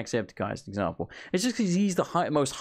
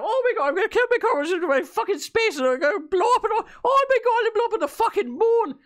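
much higher, louder hyper manic gamer yell; mock Jacksepticeye; 6 dB louder, faster than usual. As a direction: scream it like an overexcited hyper youtuber. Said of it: "the guy's like".

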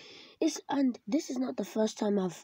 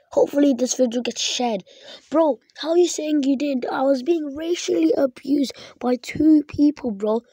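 loud, higher, pressing every word; angry callout; 4 semitones higher, 6 dB louder than usual. Real, not acted. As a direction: confront him straight to camera. Calling out the abuser directly.

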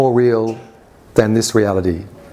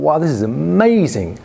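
higher, swooping wonder; awed amazement; 4 semitones higher than usual. Retold or imitated. Narrator reliving the sight of light orbs, awed amazement. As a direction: marvel at it, voice rising. Retold awe at seeing orbs.

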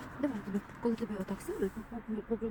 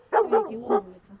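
high, loud happy praise; excited praise; 10 semitones higher, 11 dB louder than usual. Real, not acted. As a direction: cheer the dog on.

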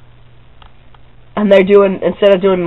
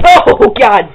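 loud, high yelp of real pain; real sudden loud pain; pained outcry; 7 semitones higher, 6 dB louder than usual. Real, not acted. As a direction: cry out as the landing hurts. Flops onto floor, hurts himself.

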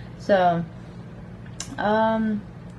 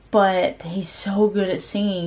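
usual speaking voice

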